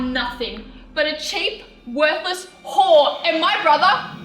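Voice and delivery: loud, emphatic outrage, hitting key words hard; furious denunciation. Acted. Sister defending her brother to the woman who fooled them, furious. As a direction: lash out with rising force.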